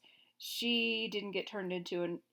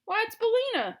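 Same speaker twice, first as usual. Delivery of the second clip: higher, louder light reply; surprised Scarecrow; 14 semitones higher, 9 dB louder than usual. A narrator's reading. Scarecrow telling the king it's the hen, high and bright. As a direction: chirp the answer.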